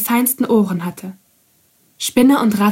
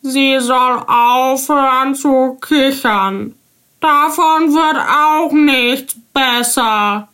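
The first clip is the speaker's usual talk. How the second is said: high, slow, slightly too loud toad; a bit too loud; 4 semitones higher, slower than usual. A narrator's reading. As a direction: complain a little too loudly. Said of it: "sagte die Kröte etwas zu laut".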